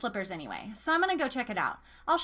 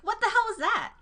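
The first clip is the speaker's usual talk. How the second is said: sharp high alarmed question; startled; 11 semitones higher, 3 dB louder than usual. Acted. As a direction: jump at the noise. Startled by the phone call.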